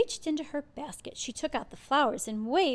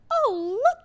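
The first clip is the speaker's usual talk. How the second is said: high, louder delighted exclaim; excited girl; 13 semitones higher, 9 dB louder than usual. A narrator's reading. Girl spotting acorns under the oak, high and excited. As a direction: gasp it out in wonder. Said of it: "she said".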